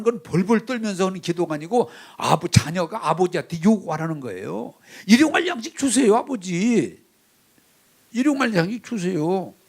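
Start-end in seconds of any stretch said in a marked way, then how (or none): 5.1-9.5 high, insistent plea to a father; bold demanding prayer. Shown as a demonstration. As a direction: call out the request with confidence. Models bold asking in prayer.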